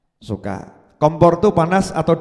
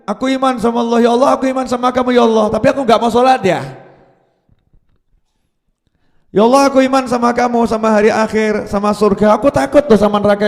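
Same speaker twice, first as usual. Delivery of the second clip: hurried, imitating a believer bargaining with God; glib self-professed believer; 2 semitones higher, faster than usual. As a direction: rattle off a wheedling prayer full of excuses. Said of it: "Ini ngaku".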